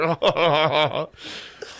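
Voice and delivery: high, put-on nervous chuckling; imitated nervous laugh. Host imitates comic running past to the stage, mock nervous laughing. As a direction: fake a nervous laugh on the run. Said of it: "nervously laughing".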